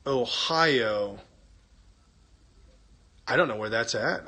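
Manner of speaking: usual speaking voice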